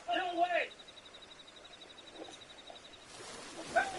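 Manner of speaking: high, panicked shouts at an attacker; frantic yelling. Real, not acted. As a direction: scream to keep the attacker off. Played clip of street attack.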